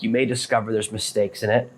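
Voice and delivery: ordinary delivery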